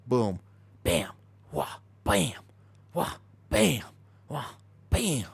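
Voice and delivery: imitated gun blasts, bam and wah of recoil; punchy mouth-made gunshot sounds, then a recoil wah; playful imitated shotgun booms; mock gunshot noises. Showing shotgun clip, mimicking shots.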